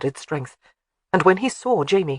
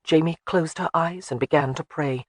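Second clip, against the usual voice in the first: ordinary delivery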